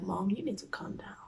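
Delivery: barely voiced, scared plea; timid whisper. Daughter in trouble, whispering to her furious mom. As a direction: whisper it so she can't hear.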